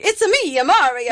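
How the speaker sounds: a light Italian-cartoon accent, only lightly voiced; Mario impression. Co-host giving a light Mario voice for an Italian accent. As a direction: bounce it like a lightly done Mario. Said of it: "Your best Snooki".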